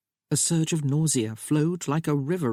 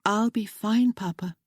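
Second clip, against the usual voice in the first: a higher, lightly voiced young woman, earnest and pleading; young bride Sophia; 6 semitones higher than usual. A narrator's reading. Bride reassuring her new husband, raised lighter voice. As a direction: play the bride gently begging James to understand.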